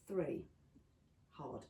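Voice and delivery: ordinary delivery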